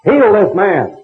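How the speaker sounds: commanding God. Retold or imitated. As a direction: bark the prayer like a command. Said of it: "just ordered God around".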